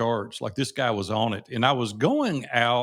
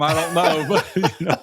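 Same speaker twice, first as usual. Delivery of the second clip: laughing; 4 semitones higher, 4 dB louder than usual. Real, not acted. Laughing at his own joke.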